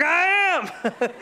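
high, mock-thrilled reply; match the child's glee, hammed up; excited. Passenger playing along with little girl, excited.

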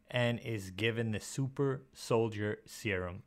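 slow, deliberately spaced-out words; extremely slow. Real, not acted. Host reading the book's premise, saying it extremely slow. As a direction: draw the phrase out slowly, word by word. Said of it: "say that extremely slow".

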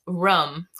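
"Room" said like "rum"; short uh vowel in "Room"; local pronunciation. Shown as a demonstration. Listing local words, "Room" with a short vowel. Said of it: "things that people say here".

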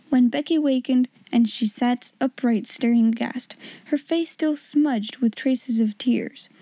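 ordinary delivery, nothing marked

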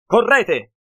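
a father yelling at his family to flee; snapping shout. A narrator's reading. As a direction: bark the order to run at full force. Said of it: "sbottò Lord Leipzig".

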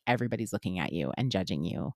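a hushed, judging inner voice; inner critic voice. Retold or imitated. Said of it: "my inner critic is always just like".

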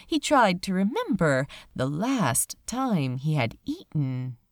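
plain reading voice

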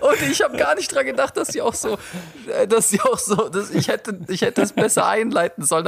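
high, half-sobbing laughter through the words; telling it while barely holding it together; laugh-crying. Real, not acted. Lacht-weinend erzählt.

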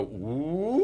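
soft "woo" swooping upward; imitated warm-up glide, rising steadily; soft rising choir-exercise whoop; low-to-high vocal siren. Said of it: "He would go from low to high".

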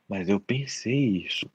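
slow, soft, low acted regret; guilty fixation. Psychologist voices someone stuck on a thought, guilty murmur. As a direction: dwell on it, sinking and hushed.

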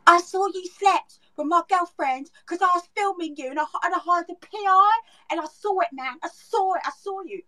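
high-pitched British talk-show accuser; hammed-up British accent, accusing a cheat on TV; British trash-TV guest accent. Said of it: "more of those accents of those people".

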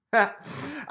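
short high burst of laughter. Real, not acted. Host laughing at his own Les Mills tease with a short, high laugh.

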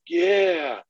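eager yes, voiced as a small child; imitated excited kid. Son offered his fire truck bed, imitated excitement.

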